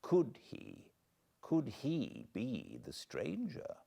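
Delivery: ordinary delivery, nothing marked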